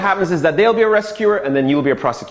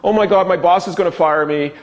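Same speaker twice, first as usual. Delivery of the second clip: overwhelmed excuse-maker, pitched up; whiny victim; 7 semitones higher than usual. Shown as a demonstration. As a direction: play the helpless victim begging off. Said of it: "The victim is the".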